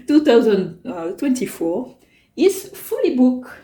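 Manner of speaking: English read slowly in a thick French accent, laughing at herself; careful, self-mocking French-accented English; strong French accent. Real, not acted. Reading the planner box to viewers, slow French-accented English.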